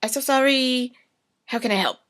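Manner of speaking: high, gushing apology; mock apologetic gush. Man gushing apology to fired woman, high and overdone. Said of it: "And he's like".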